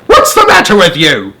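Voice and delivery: high, loud screech. Acted. Spiker screeching at crying James, loud and high. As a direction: screech at the boy. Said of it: "Aunt Spiker screeched".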